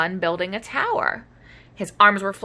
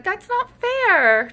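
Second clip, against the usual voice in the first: whiny protest; 13 semitones higher, 4 dB louder, much slower than usual. Acted. Child dino protests.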